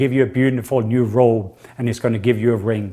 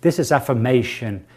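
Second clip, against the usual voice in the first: usual speaking voice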